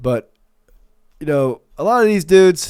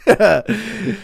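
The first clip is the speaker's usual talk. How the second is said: short laugh; 3 semitones higher than usual. Real, not acted. Laugh after joke.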